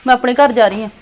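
loud, angry outburst at the husband; angry shout. Acted. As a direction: yell it at him in fury. Wife quarrelling, leaving husband's home. (ਗੁੱਸੇ ਵਿੱਚ ਉੱਚੀ ਚੀਕ)

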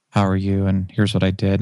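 low, monotone mic voice; nervous monotone. Shown as a demonstration. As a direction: drone it like a nervous podcaster. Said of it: "there's a lot of monotone people".

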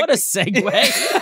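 slow, laughing remark. Real, not acted. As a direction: laugh through the words. Laughing at the segue.

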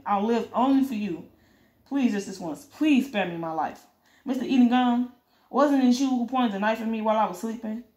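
plain reading voice

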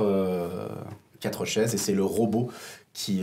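ordinary talk, nothing marked

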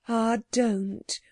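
ordinary delivery

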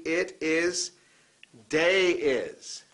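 ordinary talk

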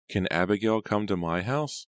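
put-on high little girl calling, lilting up at the end; singsong child's question from the grave; high, eerie girlish call. A narrator's reading. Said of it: "in the same girlish voice".

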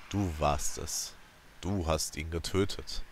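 low, soft and slow; slow pressing words. A narrator's reading. Gongora slowly forcing the accusation on her. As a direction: press each word slowly into her ears. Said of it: "als wolle er seine Worte langsam in meine Ohren pressen".

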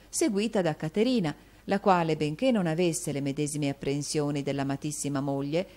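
plain reading voice

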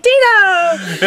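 high, loud gleeful cry of a name; excited shout. Retold or imitated. Friend greeting a buddy with a hug, gleeful shout.

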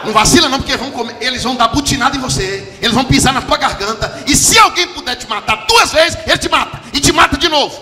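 high, loud, urgent preaching; preacher shouting a warning to the church; shouted warning. Real, not acted. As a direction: warn them at the top of your voice. Preacher warning at full force.

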